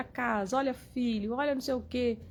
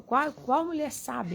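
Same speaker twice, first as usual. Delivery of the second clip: ordinary delivery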